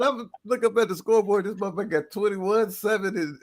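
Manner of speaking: high, disbelieving voice; incredulous retelling. Retold or imitated. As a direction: raise it up in disbelief at the score. Retelling incredulous scoreboard moment.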